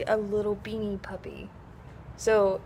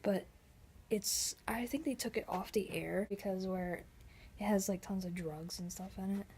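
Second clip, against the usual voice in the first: hushed whisper so nobody is disturbed; library whisper; 4 semitones lower, 15 dB quieter than usual. Real, not acted. Vlogging inside the library to viewers, whispering. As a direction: keep it breathy and quiet like among the shelves.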